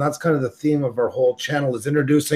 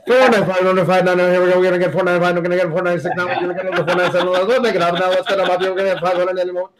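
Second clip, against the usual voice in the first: auctioneer voice; 3 semitones higher, faster than usual. Pretend. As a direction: rattle off bids like an auctioneer. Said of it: "Do I have to start doing the auctioneer voice again?".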